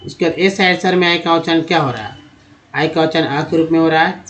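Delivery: ordinary delivery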